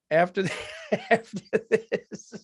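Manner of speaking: laughing. Real, not acted. Laughs at own gloom.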